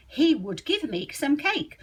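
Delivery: he would, both words said in full; full form, slow. Shown as a demonstration. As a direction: stiff and careful, like a book. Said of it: "how would you say it".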